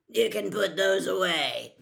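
put-on gruff, loud old auror; growled. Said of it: "he growled".

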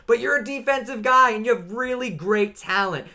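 loud indignant rant at Buffalo's coach; fed-up rant. Real, not acted. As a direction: vent straight at the team. Ranting at the coach.

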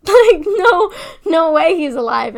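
high, loud, laughing disbelief; stunned disbelief. Retold or imitated. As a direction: cry out in utter shock. Disbelief at news dad alive.

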